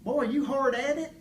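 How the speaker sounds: high, loud country-character question; Gene Rader. Retold or imitated. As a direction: imitate an eccentric Texan hailing someone. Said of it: "I looked at him and said".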